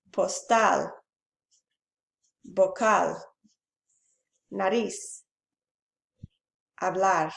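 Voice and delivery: "Trabajador", "Profesor", "Postal", "Vocal", "Nariz", "Hablar", "Comer", each stressed on its last syllable; isolated example words, weight on the end of "Trabajador" through "Comer"; teacher's word list, final syllable stressed from "Trabajador" to "Comer". Shown as a demonstration. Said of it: "stress on last syllable".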